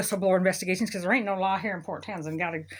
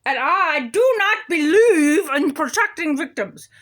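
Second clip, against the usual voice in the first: slow, loud, higher pompous judge voice; mocking the judge; 5 semitones higher, 6 dB louder, slower than usual. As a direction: ham up a smug judge declaring herself. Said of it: "She's like".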